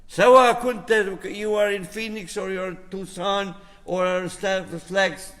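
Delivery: ordinary talk, nothing marked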